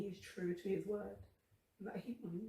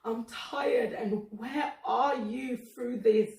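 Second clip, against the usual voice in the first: louder, higher, tearful and slow; crying; 5 semitones higher, 10 dB louder, much slower than usual. Real, not acted. Asking God where he was, crying. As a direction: let the sob break through the words.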